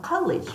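"college" struck high at the start, then falling; stressed first syllable. Shown as a demonstration. Teacher modelling stress for students, "college" punched high on its first syllable. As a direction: hit the start of "college" from above. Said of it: "자, 위에서 탁 때려주세요".

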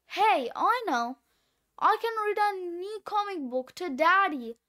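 ordinary delivery

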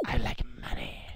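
unvoiced whisper aside. Real, not acted. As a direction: whisper it like a secret.

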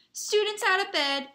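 high, loud alarm call; bellow. A narrator's reading. Peeves raising the alarm on students, bellowing. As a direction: bellow it down the corridors. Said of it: "Peeves belled".

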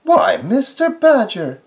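high, loud scolding mouse. Acted. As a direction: scold him sharply, high. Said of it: "scolded Missus Field Mouse".

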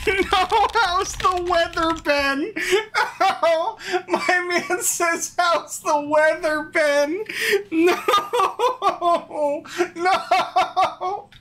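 high, slow wail through laughter; anguished laughing. Real, not acted. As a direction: moan it helplessly, laughing and whining. Agonizes over small-talk option.

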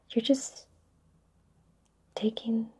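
ordinary talk, nothing marked

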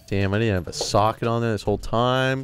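usual speaking voice

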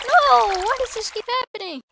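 high, loud cry of exasperation; frustrated yell. Real, not acted. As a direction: yell out in dismay as it goes wrong again. Setback keeps happening in game.

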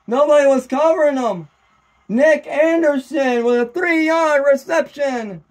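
high, amazed sportscaster; excited recap. Real, not acted. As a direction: announce the scorer still buzzing from the touchdown. Recapping the shock touchdown.

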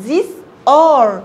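firm and loud. Shown as a demonstration. Teacher sets it against the light this for pupils. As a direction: give it weight. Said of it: "لكن دي".

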